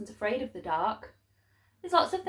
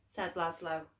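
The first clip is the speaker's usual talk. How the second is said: usual speaking voice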